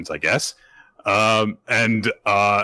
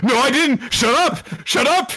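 much higher, bratty shut up; six-year-old squabble; 3 dB louder than usual. Retold or imitated. Damien denying crying, high bratty childish retort. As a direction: snap back like a sulky kid. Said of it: "they're arguing like six-year-olds".

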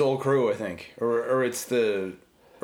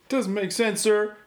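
mock soldier; 10 semitones higher than usual. Quoting soldier in movie.

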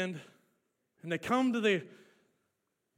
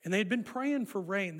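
usual speaking voice

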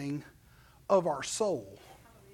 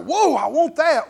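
higher, louder grabby whoa; playful louder eager want; mock covetous whoa; 6 semitones higher, 8 dB louder than usual. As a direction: gasp like a kid at a toy. Acting out wanting something.

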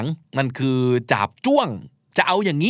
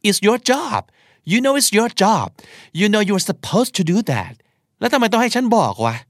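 annoyed scolding; 8 semitones higher, much slower than usual. Acted. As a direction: scold like you're fed up with them. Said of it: "จริงๆ อยากจะด่า". (ตำหนิอย่างหงุดหงิด เสียงสูงและดังขึ้น)

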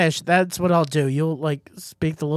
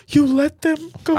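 higher, louder accusing voice; mock accuser; 10 semitones higher, 6 dB louder than usual. Imagined critics blaming him, higher and louder. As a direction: fling the blame like an angry critic. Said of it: "they'd be like".

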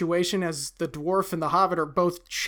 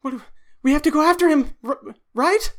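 nervous question; 8 semitones higher, 4 dB louder, faster than usual. Acted. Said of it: "and he says".

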